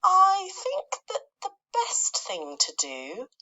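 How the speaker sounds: slow, high, careful words; slow and thoughtful. Acted. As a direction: think aloud, drawing it out. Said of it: "James said slowly".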